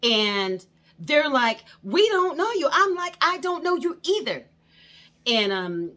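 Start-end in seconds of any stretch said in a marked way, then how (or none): 1.8-2.7 high, loud mock accusation; imitating a suspicious neighbor's loud challenge; accusing shout. 3.2-4.5 high, loud snapped comeback; retold angry comeback; indignant retort.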